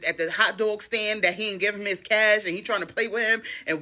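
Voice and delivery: ordinary talk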